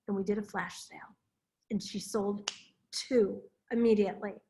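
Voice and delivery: ordinary talk, nothing marked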